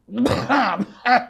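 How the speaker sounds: high, rasping put-on cough; acted cough. Storyteller acts the husband's cough, a raspy cough. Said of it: "अब उनको खांसी आई।".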